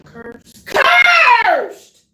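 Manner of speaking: loud, high shouted preaching; preaching at full force. Acted. Preacher crying out Jeremiah to the congregation, loud and high. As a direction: thunder the scripture at them, slow and loud.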